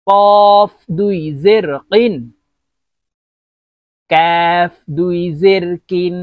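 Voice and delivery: slow, drawn-out recitation of each letter; Qaida spelling drill. Shown as a demonstration. Teacher modelling letters for learners, slow measured recitation.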